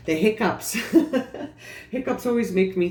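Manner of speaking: embarrassed giggle at own hiccups; laughing. Real, not acted. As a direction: laugh it off lightly. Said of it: "Sorry, I have the hiccups".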